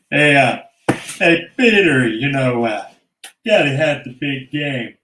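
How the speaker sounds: loud cartoon call. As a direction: holler across the room like a cartoon neighbour. Voiceover Family Guy bit.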